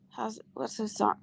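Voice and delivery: soft, whispered self-questioning; whispering to oneself. Real, not acted. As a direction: whisper it while searching your memory. Trying to recall song to herself.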